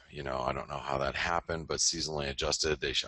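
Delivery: usual speaking voice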